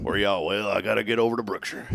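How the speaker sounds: put-on Southern country twang; country accent. Host mocks how the store name sounds, hammed-up country accent. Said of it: "That sounds real country".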